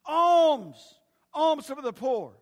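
loud, drawn-out street cry for alms; screamed beggar's cry. Acted. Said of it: "he was no longer screaming".

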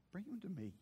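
very soft, restrained reply; quiet command. Retold or imitated. Jesus answering the desperate father, hushed calm command. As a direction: say it hushed, after a pause.

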